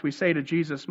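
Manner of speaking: ordinary delivery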